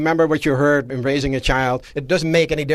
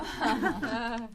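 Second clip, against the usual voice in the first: amused laugh; 8 semitones higher, 10 dB quieter than usual. Real, not acted. Laughs after regift joke.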